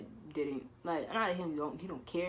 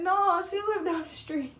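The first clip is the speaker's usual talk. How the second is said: high, loud, slower laughing repeat; 8 dB louder, slower than usual. Real, not acted. A teen laughing as she repeats her lie, high and slow. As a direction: laugh through the denial.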